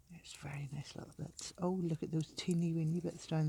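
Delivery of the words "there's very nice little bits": hushed whisper to the camera. Real, not acted. Beachcomber sharing a find, soft whisper. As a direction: breathe it out softly.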